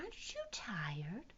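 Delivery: soft, slow giraffe's question; lightly voiced, hushed giraffe; giraffe asking. A narrator's reading. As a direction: ask it gently, stretching the words. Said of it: "asked the giraffe".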